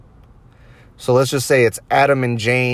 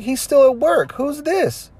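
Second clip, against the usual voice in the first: much higher falsetto imitation of a woman; mock wife voice; slower than usual. Wife answering the prank call, mock falsetto. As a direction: play the wife in a squeaky put-on voice.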